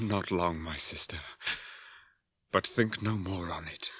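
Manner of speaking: tender whispered comfort; gentle whisper. Acted. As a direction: hush her gently. Comforting weak sister.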